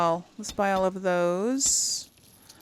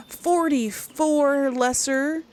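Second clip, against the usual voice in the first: higher, louder, slow delighted count; impressed gasp; 6 semitones higher, 6 dB louder, slower than usual. Real, not acted. Player counts the potions made, pleasantly surprised. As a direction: gasp and draw out the happy number.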